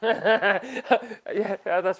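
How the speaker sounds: real chuckle running into the word; amused, breaking into laughter; laughing agreement. Real, not acted. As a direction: agree while still laughing. Laughing at a viewer's comment.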